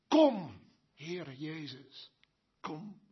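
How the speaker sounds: hushed, tearful, slow plea; tender prayer. Acted. Preacher voices the church praying for Jesus, softly tearful. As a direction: breathe it as a heartfelt prayer.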